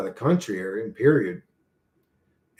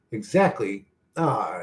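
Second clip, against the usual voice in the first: usual speaking voice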